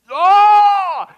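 high, loud cry of disgust; loud exasperated oh; dramatic oh. Acted. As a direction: exclaim at the stench. Exclamation at sin's stench.

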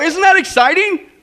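high, loud, thrilled questions; excited preaching. Real, not acted. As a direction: fire up the congregation. Stirring memory of being saved.

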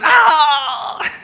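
high, loud mock yelp of pain; pained cry. Lady in the joke yelping to her doctor as she touches her arm. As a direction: yelp as if the arm really hurts. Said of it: "and she goes".

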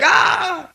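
high, startled "ah"; acted yelp of alarm; startled mock cry. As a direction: cry out as if suddenly yelled at. Acts startled reaction to being yelled at.

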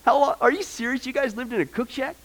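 asking Grandma in playful disbelief; incredulous grandson. Retold or imitated. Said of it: "I said".

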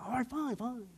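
acted exasperated surrender; grudging giving-in. Gives in to the nagging thought.

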